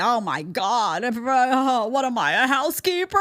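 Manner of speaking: rising, indignant whine, hammed up; hammed-up indignation climbing high in pitch; mock outrage. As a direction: imitate someone scandalized at being asked to do chores. Mocks outraged women refusing chores.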